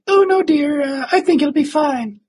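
very high falsetto housewife; put-on high, fussy reassurance; mock wife. Imagined wife soothing her husband, high mock falsetto. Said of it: "If I was a wife".

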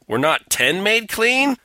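mock-indignant Jesus, pitched high; indignant questioning. Jesus asking after the other nine lepers, indignant. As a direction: ask in rising, put-on indignation.

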